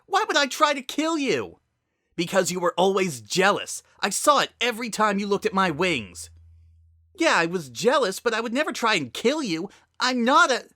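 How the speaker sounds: high, loud pleading anger; angry shout. Acted. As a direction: yell in desperate defense. Heated quarrel with sister.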